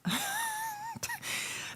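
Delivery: very high-pitched laugh; real laugh. Real, not acted. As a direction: laugh in a long high squeal. Laughs after teasing exchange.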